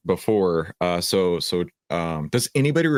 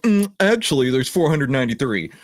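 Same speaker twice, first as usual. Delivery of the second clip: high, louder nasal-free mock pedantic voice; mocking trolls correcting the stock count, higher; mocking know-it-all trolls; 6 semitones higher, 3 dB louder than usual. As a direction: mimic smug online nitpickers. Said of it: "all the trolls came out and they're like".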